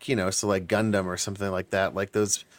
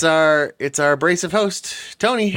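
announcer voice; 5 semitones higher than usual. Acted. Announcing the host.

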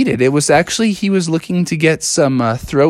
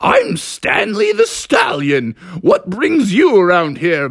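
slow, high horse voice; big cartoon horse announcing himself; Stanley the Stallion; 3 semitones higher than usual. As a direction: introduce yourself with a drawn-out whinny. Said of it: "And he said".

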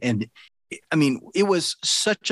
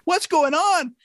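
put-on much higher, a small bewildered child; scared kid voice; slower than usual. Eight-year-old hearing chaos with eyes covered, high puzzled squeak. Child self wondering in the dark.

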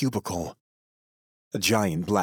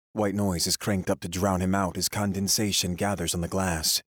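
ordinary delivery, nothing marked

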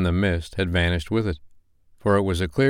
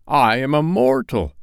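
higher, pleading protest; remonstrating old Scrooge; 6 semitones higher, 4 dB louder than usual. Acted. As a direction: protest nervously to the spirit. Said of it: "Scrooge remonstrated".

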